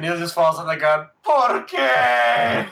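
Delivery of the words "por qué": high, loud, drawn-out lament; mock-anguished wail. Friend acts Neil falling to the ground, mock-anguished Spanish wail. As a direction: wail it like a melodramatic hero.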